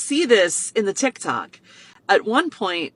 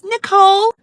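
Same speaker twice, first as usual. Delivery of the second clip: put-on high, loud child saying her name; little girl; 14 semitones higher, 10 dB louder than usual. Birthday girl telling her name, high loud child voice. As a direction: answer brightly like a small child. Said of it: "She goes".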